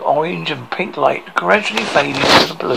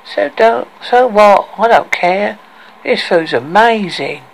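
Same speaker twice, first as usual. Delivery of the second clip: higher, whiny nasal mermaid; whining nasal voice; 5 semitones higher, 3 dB louder, slower than usual. A narrator's reading. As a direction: whine it through the nose. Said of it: "in a whining, nasal voice".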